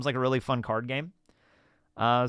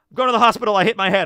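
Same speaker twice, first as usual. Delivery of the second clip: higher and louder mock mom; mom's opening line, hammed up; 7 semitones higher, 7 dB louder, faster than usual. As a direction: replay her dramatic opener, exaggerated. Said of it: "Like, like".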